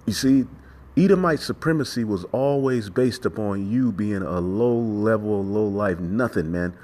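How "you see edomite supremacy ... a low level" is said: normal talking voice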